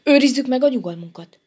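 hurried call for calm; cutting in. A narrator's reading. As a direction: butt in briskly. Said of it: "Vágott közbe Dínye".

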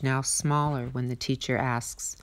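ordinary delivery, nothing marked

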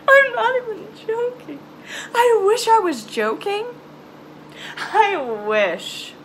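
near tears. Real, not acted. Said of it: "I wanna cry right now".

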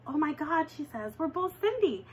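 usual speaking voice

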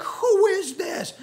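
high astonished gasp of a question. Retold or imitated. Frightened disciples to one another, high and astonished. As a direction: gape at the stilled storm in a high voice. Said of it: "They were like".

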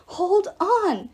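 high, urgent command; talking arrow. A narrator's reading. Arrow keeping Apollo alive, high urgent exhortation. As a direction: exhort with stretched emphasis. Arrow urges fading hero on.

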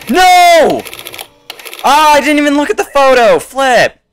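high, very loud outburst; frustrated yell. Real, not acted. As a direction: yell in dismay at your own slip. Realizes a mistake in the game.

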